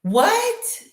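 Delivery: high, startled what; surprised. Real, not acted. Reacting to a viewer's comment with a high startled what. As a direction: shoot up in disbelief.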